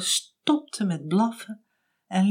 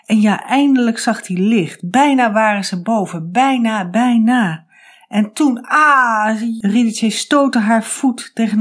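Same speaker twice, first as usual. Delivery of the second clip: rising excitement; 3 semitones higher, 6 dB louder than usual. A narrator's reading. Orpheus nearly reaches the light.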